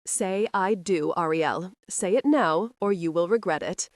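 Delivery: ordinary delivery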